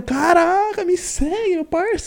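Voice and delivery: mock-beg an idol in falsetto; pleading fanboy. Reenacts fan asking for follow. (fã implorando em falsete, de brincadeira)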